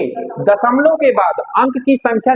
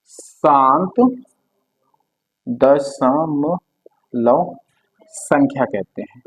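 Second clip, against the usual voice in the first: very slow, lower, word by word for notes; slow dictation; 6 semitones lower, much slower than usual. Shown as a demonstration. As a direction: drag each word out so students can write. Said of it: "teacher dictating definition". (धीमे स्वर में लिखवाना)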